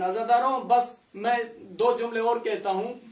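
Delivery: usual speaking voice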